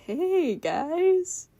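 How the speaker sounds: high-pitched, effusive greeting; Pippi-ish personality. Acted. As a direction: gush like a peppy new acquaintance. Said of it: "like, Pippi-ass personality".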